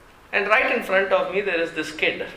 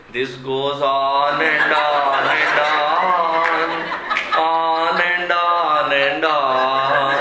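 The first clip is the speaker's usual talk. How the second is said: put-on bored kid; 4 dB louder, much slower than usual. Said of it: "he turns to his friend and says".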